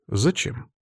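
a short note of surprise; surprised. A narrator's reading. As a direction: ask in mild bewilderment. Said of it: "удивился Олег".

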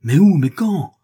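Joey yelling at the indifferent host; exasperated yell. A narrator's reading. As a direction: hurl the questions in frustration. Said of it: "hurle Joey qui s'exaspère".

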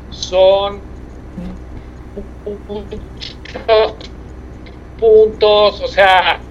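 choppy, breaking-up phone audio; crackly cordless phone. Real, not acted. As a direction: voice cutting in and out on a bad mobile line. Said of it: "se escucha un poco entrecortado".

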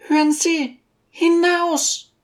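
very high, commanding lady's voice; impatient Besjukina. A narrator's reading. Lady repeats her order to the teacher, impatient. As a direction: order him out in a high character voice. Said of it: "rief die Besjukina ungeduldig".

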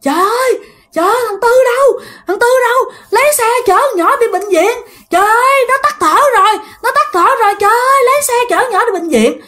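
very high, frantic cry for help; grandma screams for someone to fetch the car; grandmother shouting. Retold or imitated. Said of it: "ngoại bạn la làng". (bà ngoại la làng, giọng rất cao hoảng loạn)

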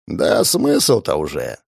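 slow mumble into the floor; talking doll. Acted. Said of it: "Всё так же бубнил я в пол".

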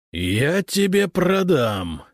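slow, high, drawn-out roar; indignant bellow; outraged roar. A narrator's reading. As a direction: roar a threat in fury. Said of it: "взревел Прудников".

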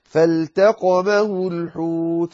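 فالتقمه الحوت with the lam in الحوت sounded clearly; slow careful فالتقمه الحوت, lam not merged. Shown as a demonstration. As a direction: say الحوت pronouncing the L fully, slowly. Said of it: "عليك أن تظهر اللام".

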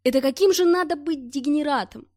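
furious outburst. A narrator's reading. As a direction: spit out the contempt. Narrator rages at Glushkov. (громкая яростная тирада)

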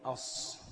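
الص whispered as aṣṣ, no l; isolated merged sound. Shown as a demonstration. Teacher isolates the merged sound for students, الص breathed. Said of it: "مش ال،".

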